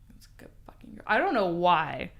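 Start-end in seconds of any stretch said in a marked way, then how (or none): 0.1-1.0 hushed, low bedroom whisper of a dominant man; imitating his whisper. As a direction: whisper it down at her like he did. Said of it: "he was like".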